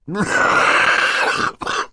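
long, loud hacking cough; acted coughing fit. Mister Poe interrupts himself with a coughing fit. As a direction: cough at length in character.

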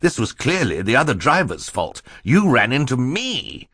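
protesting banker. Acted. Mister Poe blames the other driver, protesting. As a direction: insist the fault is someone else's.

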